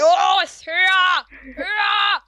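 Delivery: loud, high, pushing yell; urgent shouting. Real, not acted. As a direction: urge them on at full voice. Urging teammate up in game.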